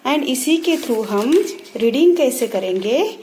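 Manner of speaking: ordinary delivery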